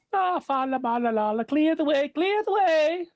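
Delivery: shrill war cry. Acted. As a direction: cry to battle, shrill and wild. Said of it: "shrill war cry".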